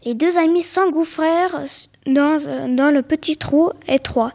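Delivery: ordinary delivery, nothing marked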